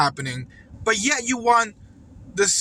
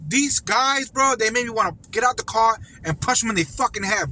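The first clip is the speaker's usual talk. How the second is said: ordinary talk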